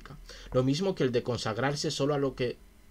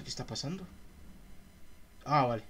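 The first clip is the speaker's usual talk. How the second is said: usual speaking voice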